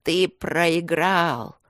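very slow, loud demonic gloat. A narrator's reading. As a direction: draw out the victory. Evil gloats over fallen boy. (злорадный потусторонний голос)

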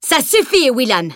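high, forceful cut-off, falling on the name; stern, angry reprimand; furious rebuke. A narrator's reading. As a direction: slam the door on the argument. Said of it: "asséna le maître d'armes".

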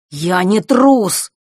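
defensive snarl; snapping back. A narrator's reading. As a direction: bite back at the accusation. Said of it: "огрызнулся Фэш".

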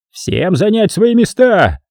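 high, slow commanding call; barked order. A narrator's reading. Sergeant ordering soldiers to positions, high barked command. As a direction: order the men to their posts. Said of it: "приказал фельдфебель".

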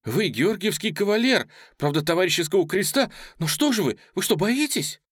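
high, agitated challenge; breathless reproach. A narrator's reading. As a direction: taunt with rising indignation. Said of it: "задыхаясь, говорила Оля, сама себя не понимая".

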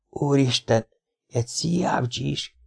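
soft, strained groan of dismay. A narrator's reading. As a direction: moan the words out in shock. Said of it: "nyögött fel". (döbbent nyögés)

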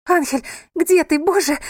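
high, loud desperate call. A narrator's reading. Wife calls to her missing husband, desperate calling out. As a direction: call out searching for someone.

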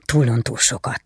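bitter, pained. Acted. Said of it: "inkább csak magának mondta, keserűen, fájdalommal".

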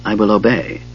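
soft, hushed obedience; bow your voice in respect; reverent. A narrator's reading. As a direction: Sturm swears obedience to Goldmoon. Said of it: "he said reverently".

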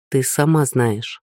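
usual speaking voice

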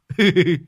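high-pitched tittering laugh; giggle. Acted. Rider pulls up by his friends, giggling. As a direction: let out a short high giggle.

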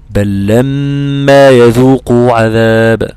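بل لما with the lam doubled into لما, slow; merged lam. Shown as a demonstration. Teacher recites a verse showing lam merging into lam. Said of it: "فتدغم في اللام للتماثل مثل إيه؟".

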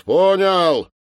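high, loud call back; shouted acknowledgement. Acted. Sergeant answering the captain from downstairs, shouting. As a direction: holler that the order is received.

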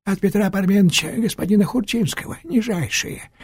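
put-on high, fawning old trickster; bow and scrape, voice lifted high; sly old man voice. A narrator's reading.

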